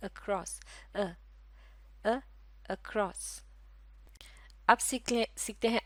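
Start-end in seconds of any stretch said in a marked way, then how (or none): 0.1-3.4 "across" softly, with "अ" isolated; soft demo "across"; across again. Shown as a demonstration. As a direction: model the weak first vowel of "across". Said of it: "इसके शुरू में ये आवाज़ है अ की आवाज़".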